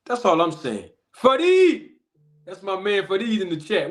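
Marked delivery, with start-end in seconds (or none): 1.2-1.9 loud, high shout of a viewer's name; calling out. Real, not acted. Host calling out to viewer Fadeed in chat, loud and high. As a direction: call out to a friend spotted in the chat.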